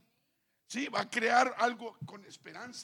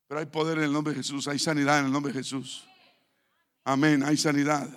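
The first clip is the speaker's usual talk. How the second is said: louder declaration, building to amén, hay sanidad; fervent preaching; 3 semitones lower, 9 dB louder than usual. Acted. Pastor declaring healing over a sick man, fervent. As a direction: proclaim it like a victory.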